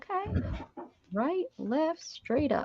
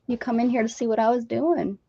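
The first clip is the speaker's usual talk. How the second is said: ordinary delivery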